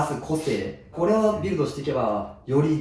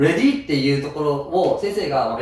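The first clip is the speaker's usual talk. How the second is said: usual speaking voice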